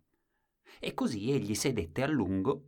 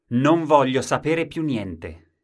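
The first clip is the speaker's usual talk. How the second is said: loud, slow, stubborn refusal; stubborn shout; 6 semitones higher, 10 dB louder, slower than usual. Acted. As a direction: bellow it out like a stubborn man.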